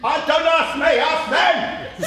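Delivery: loud, high alarm; panicked shout. Acted. Nurse shouting in panic for the lost girl. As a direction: raise the alarm across the market.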